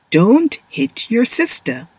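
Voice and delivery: ordinary delivery, nothing marked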